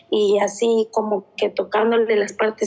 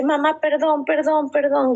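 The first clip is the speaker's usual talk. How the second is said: higher, pleading sorry; fearful apology; 5 semitones higher than usual. Retold or imitated. Mother voices daughter apologising for wetting the bed, afraid. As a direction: beg forgiveness like a scared child.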